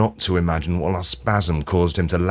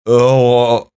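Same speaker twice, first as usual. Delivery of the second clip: short pained "Oh" moan, louder; a stunned, hurt little moan; 3 semitones higher, 6 dB louder than usual. Retold or imitated. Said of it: "do a little moan that goes like this".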